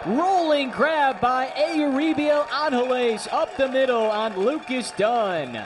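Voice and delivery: rising, loud play-by-play shout; excited call. Real, not acted. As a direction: call the diving grab with a burst of excitement. Big defensive play called live.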